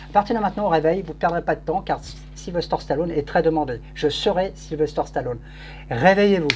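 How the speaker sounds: ordinary talk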